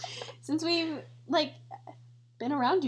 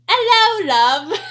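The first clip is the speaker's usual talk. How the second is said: loud, high mock British greeting; hammed-up British accent on the verge of laughing; 5 semitones higher, 10 dB louder, slower than usual. Reading a British people tweet aloud, mock British accent. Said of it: "British people be like".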